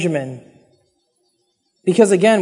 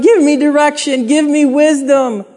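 higher-pitched, lightly pleading prayer; acted crying out; 5 semitones higher than usual. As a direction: re-enact an urgent appeal to God. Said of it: "I cry out to God".

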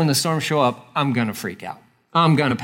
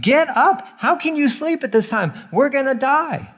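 much higher, frantic yell; panicked sailors' yell; 11 semitones higher than usual. Retold or imitated. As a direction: wake Jonah in terror. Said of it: "They, they're like".

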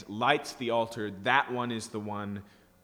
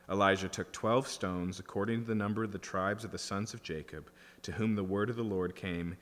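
normal talking voice

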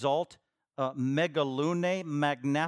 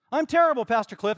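loud, high, exaggerated confession; over-the-top mock despair; mock self-loathing sinner; 10 semitones higher, 12 dB louder than usual. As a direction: wail it out like a hammed-up penitent. Acts out a sinner confessing to pastor.

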